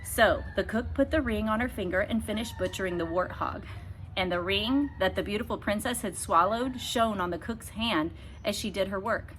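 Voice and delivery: ordinary delivery, nothing marked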